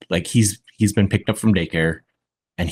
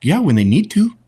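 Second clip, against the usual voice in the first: put-on much higher small boy stating the obvious; matter-of-fact little boy; 11 semitones higher than usual. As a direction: answer like a sure four-year-old. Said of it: "This little British boy".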